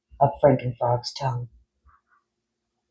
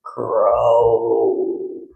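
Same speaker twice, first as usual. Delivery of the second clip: colossal croak; 3 semitones lower than usual. A narrator's reading. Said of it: "With a colossal".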